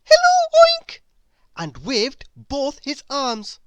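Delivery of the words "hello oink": very high, loud bird shout; shouted greeting. A narrator's reading. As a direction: shout hello across the woods. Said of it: "shouted Chirp".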